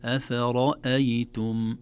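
أفرأيتم recited slowly with a heavy ra and light letters; thin every letter of أفرأيتم except the ra; correct recitation. Shown as a demonstration. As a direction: model reading of أفرأيتم. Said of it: "هكذا".

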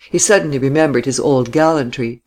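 usual speaking voice

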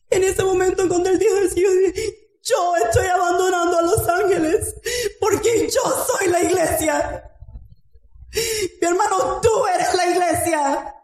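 preacher crying out to the congregation, fervent and shouting; full-force preaching. Acted.

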